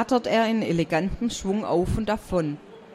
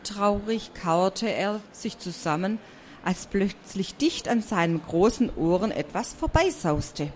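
plain reading voice

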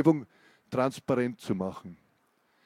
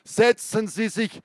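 sudden yell; 7 semitones higher than usual. Acted. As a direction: command him to sit. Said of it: "schrie ihn der Mann plötzlich an".

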